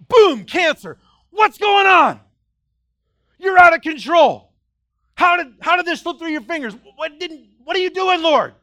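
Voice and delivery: high, loud panicked cry at God; frantic accusing cry to the Lord; panicked shout. Acted. Voicing a panicked believer to God.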